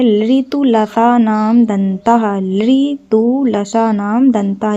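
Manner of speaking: slow, drawn-out syllables; slow mnemonic recital. Shown as a demonstration. Teacher giving students a trick to remember, slow recital. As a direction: recite it slowly for memorizing.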